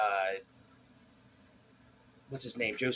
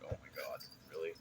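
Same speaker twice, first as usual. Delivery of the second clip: much softer, lower mock disbelief; disbelieving groan; 7 semitones lower, 16 dB quieter, faster than usual. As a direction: mutter it in weary disbelief. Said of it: "I'm like".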